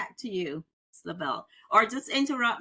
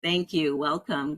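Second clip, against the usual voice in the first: usual speaking voice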